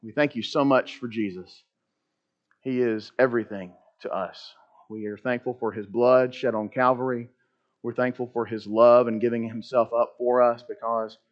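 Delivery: soft, low closing prayer. Real, not acted. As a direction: pray it quietly and reverently. Closing prayer before the lesson.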